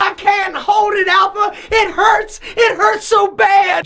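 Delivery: put-on high, pained girlfriend whine; whiny girlfriend. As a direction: beg like a girlfriend in cramps. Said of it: "She's sitting there all crampy".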